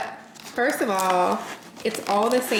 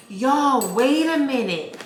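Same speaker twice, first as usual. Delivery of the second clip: ordinary talk, nothing marked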